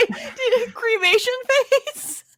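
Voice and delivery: high, squeaky-with-laughter question; incredulous, cracking up; laughing hard. Real, not acted. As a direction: ask it through helpless laughter. Cracking up at a slip.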